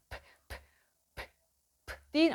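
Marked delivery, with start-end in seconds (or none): 0.1-1.0 p, p, p voiceless little lip bursts with no vowel; popped p. Shown as a demonstration. Coach demonstrating the plosive p. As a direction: pop p, p, p from the lips. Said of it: "patlamalı harftir".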